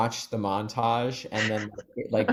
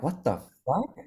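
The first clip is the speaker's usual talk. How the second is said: mock baffled outrage; 7 semitones higher, 4 dB louder than usual. Baffled tourists at closed town.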